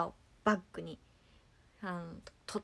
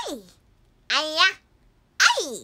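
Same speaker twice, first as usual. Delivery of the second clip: put-on loud, high baby babble; Himawari; 6 semitones higher, 10 dB louder, faster than usual. Streamer showing off a baby impression, high loud babble. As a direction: squeal it like a cartoon baby. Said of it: "ひまわりのモノマネができるよ".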